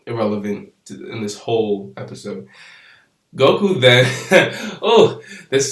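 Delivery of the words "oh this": laughing as he starts the line; amused chuckle through the words; real laugh breaking in. Real, not acted. Laughs before funny part.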